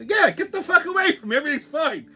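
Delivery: high-pitched, agitated brush-off; irritated brush-off. Retold or imitated. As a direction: wave him off in mock irritation. Retold snapping at man too close.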